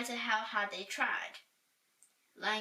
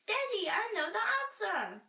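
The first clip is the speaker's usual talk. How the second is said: put-on high, eager child's voice; a daughter bursting to tell her daddy; excited little princess; 11 semitones higher, 3 dB louder than usual. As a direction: blurt it out like a keen little girl. Princess pleads with tired king.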